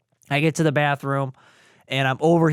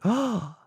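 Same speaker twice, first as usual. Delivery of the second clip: wife's shocked gasp, acted out; short high mock gasp; imitated gasp; 7 semitones higher, 4 dB quieter than usual. Wife reacting to the ultrasound news, imitated gasp. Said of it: "like, a gasp".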